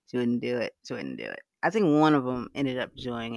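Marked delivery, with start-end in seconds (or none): 0.9-1.4 muttered echo. Real, not acted. Host tells a guest about Siri, quietly echoing herself. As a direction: repeat it much lower and softer, under the breath. Repeats line, then drops it.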